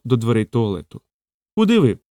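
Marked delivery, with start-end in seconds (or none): 1.6-2.0 shouting. A narrator's reading. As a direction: call out sharply to stop him. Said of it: "закричав я".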